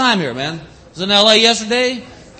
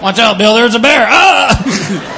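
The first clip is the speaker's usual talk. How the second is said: badly faked panic, climbing high; sarcastic play-scared cry; mock alarm; 5 semitones higher, slower than usual. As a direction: tease with a pretend warning and scream. Friend's mocking fake alarm.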